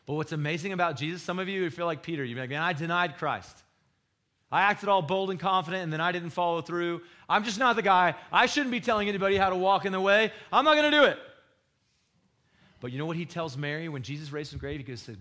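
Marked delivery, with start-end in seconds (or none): 2.5-11.2 building to a high, full shout; despairing rant that climbs louder and higher; frustrated outburst. Acted. Voicing a listener who feels like Peter, frustrated rant rising to a shout. Acted outburst of a failed follower.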